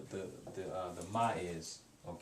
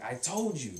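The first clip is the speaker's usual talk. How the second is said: higher and louder, a mildly annoyed I told you; exasperated told you; 6 semitones higher, 4 dB louder than usual. Shown as a demonstration. Teacher showing the student the told-you-so tone ma adds. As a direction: let some irritation into it. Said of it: "But when you add the ma on the end".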